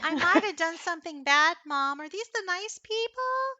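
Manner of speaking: high, small kitten calling out; kitten calling mom. Acted. Kitten calling its mother about the visitors, high and small. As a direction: call to mom like a little kitten.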